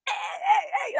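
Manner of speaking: high, giddy hey hey hey; a hyped-up greeting; mock unhinged hey. Imagining herself unhinged, a hyper high-pitched greeting. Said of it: "I'll be, like, unhinged".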